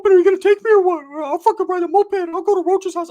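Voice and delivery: high cartoon voice; loud, squeaky mock demand; worked-up cousin. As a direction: imitate an agitated cousin in falsetto. Acting out primo's agitated demand.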